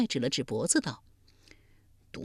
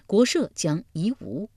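ordinary delivery

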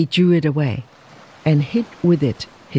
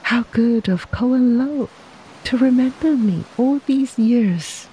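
a higher, lightly acted young wife; touch of fond gratitude in a raised voice; lightly voiced tender wife; 6 semitones higher than usual. Wife recalls her gladness at being remembered, lightly voiced tender wife.

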